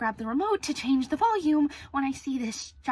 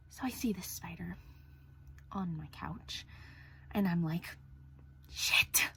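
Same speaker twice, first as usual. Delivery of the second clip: hushed; 9 semitones lower, 13 dB quieter than usual. Real, not acted. Fearful hushed retelling of spider.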